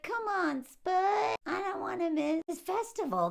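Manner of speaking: high, eager coaxing voice; chipper. Retold or imitated. Wife coaxing lazy husband to the festival, chipper high voice. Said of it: "She is so chipper".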